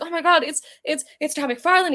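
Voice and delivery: put-on high, stuttering starstruck kid; overexcited fan. Kid spotting Todd McFarlane at the con, overexcited. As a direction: imitate a kid losing it over a hero. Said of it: "the kid was so excited".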